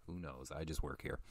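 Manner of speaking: dropped to a soft mumble, "Who knows?" said to himself; "Who knows?" almost swallowed, muttered under the breath; quiet aside. Shown as a demonstration.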